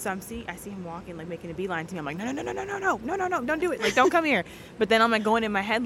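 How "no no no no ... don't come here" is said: mock-panicked high string of no's, getting louder; playful high-pitched alarm; retold panic. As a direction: plead in your head to keep someone away, pitched high. Retold panicked inner plea to stay away.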